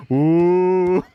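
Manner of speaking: loud drawn-out teasing ooh, then slow; mock the kid in trouble like the whole gym; a gym full of kids teasing someone sent to the office; teasing crowd ooh. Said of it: "everybody else in the gym is going".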